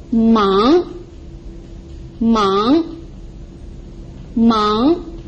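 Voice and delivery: mang drawn out, swooping up at the end; rising tone. Shown as a demonstration. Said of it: "It's like a rising tone".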